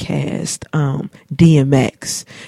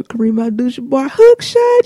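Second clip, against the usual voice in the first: much higher, announcer-style boast; hyped stepdad; 9 semitones higher than usual. Retold or imitated. Stepdad calling his video game hook shot, high hyped boast. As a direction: call your own shot like a hype commentator. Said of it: "Or he'd be like".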